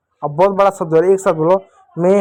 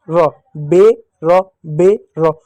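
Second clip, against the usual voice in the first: slow, louder split syllables; 3 dB louder, much slower than usual. Shown as a demonstration. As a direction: sound out each part for kids. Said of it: "तो एक साथ बोलो". (अक्षर अलग-अलग धीरे बोलना)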